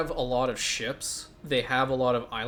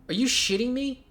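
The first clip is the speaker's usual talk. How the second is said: incredulous outburst; 11 semitones higher, 4 dB louder than usual. Real, not acted. Outraged at the quest demand.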